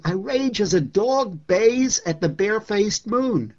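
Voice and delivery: recited with growing fury; rising rage. Acted. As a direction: let the rage build to the howl. Poem read with rising rage.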